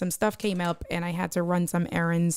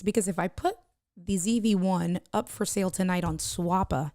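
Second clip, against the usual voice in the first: ordinary talk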